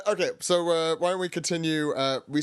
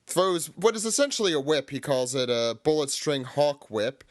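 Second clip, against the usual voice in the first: ordinary talk, nothing marked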